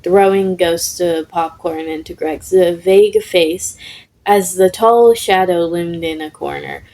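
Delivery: ordinary delivery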